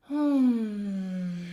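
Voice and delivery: loud sighing hum while stretching. Shown as a demonstration. Vocal coach sighing out a neck stretch. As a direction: let a big sigh go. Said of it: "switch directions".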